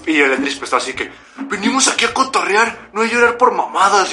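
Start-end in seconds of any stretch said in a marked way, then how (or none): 1.4-4.1 high-pitched mock telling-off; retold scolding. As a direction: imitate a fed-up friend scolding. Imita el regaño de Eldridge. (regaño imitado, con voz más aguda)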